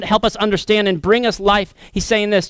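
ordinary talk, nothing marked

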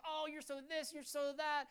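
high-pitched cooing praise; doting gushing. Acted. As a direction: fawn over a little child. Adults cooing over costumed girls.

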